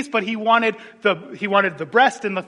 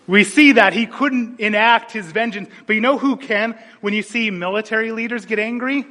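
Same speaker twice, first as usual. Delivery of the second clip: normal talking voice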